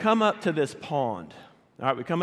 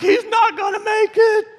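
very high, loud mock shriek; hammed-up falsetto cry of a desperate son; imitated panicked scream; 8 dB louder than usual. Son panics as dog drowns.

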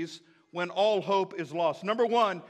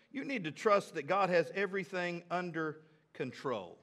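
normal talking voice

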